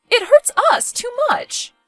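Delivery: high, loud motherly anguish; theatrical wounded cry; overwrought hurt. Acted. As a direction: plead your pain with pitch way up. Mother evicting daughter, overwrought.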